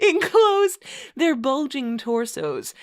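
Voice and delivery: mock-grand read-aloud, stifling laughter; story narration. Host reading a bad fanfic to co-host, mock-solemn narration. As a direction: read the purple prose with a straight face.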